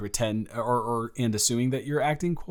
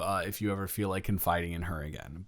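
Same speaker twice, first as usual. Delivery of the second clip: ordinary talk, nothing marked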